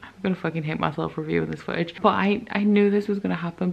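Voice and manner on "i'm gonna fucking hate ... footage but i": ordinary delivery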